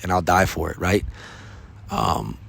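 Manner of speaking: ordinary delivery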